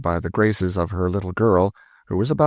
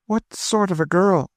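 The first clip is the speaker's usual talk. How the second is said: a high, eager question; eager sister asking about the wife; eagerly; 13 semitones higher than usual. A narrator's reading. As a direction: lean in, keen to know. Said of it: "said Madame de Thou eagerly".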